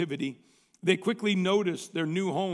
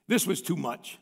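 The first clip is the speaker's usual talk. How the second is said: ordinary talk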